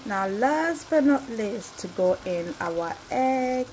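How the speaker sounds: normal talking voice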